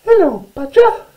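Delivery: very high, squeaky tiny mouse voice; little mouse. Acted. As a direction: exclaim in a loud mouse squeak. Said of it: "she said".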